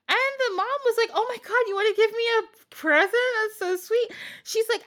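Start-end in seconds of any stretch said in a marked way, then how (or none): 1.1-4.1 high, sugary fake-friendly greeting; fake-sweet stepmom. Stepmom sweetly greets the prince, fake sugary and high. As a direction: gush like a scheming stepmother playing nice. Stepmom voiced delighted by present.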